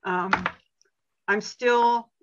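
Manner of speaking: normal talking voice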